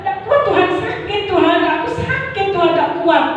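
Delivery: high, strained lament of weakness; pained plea. Retold or imitated. Preacher in pain telling God he cannot bear it, high strained plea. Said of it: "Saya berkata".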